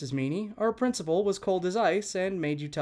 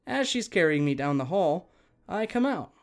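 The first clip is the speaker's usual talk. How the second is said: ordinary talk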